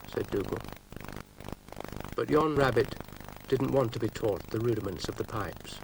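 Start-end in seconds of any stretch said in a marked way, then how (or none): none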